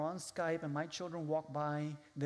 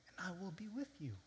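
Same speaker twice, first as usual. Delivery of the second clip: whisper; 3 semitones higher, 8 dB quieter than usual. Acted. Hushed close of verse.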